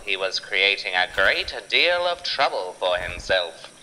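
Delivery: ordinary delivery, nothing marked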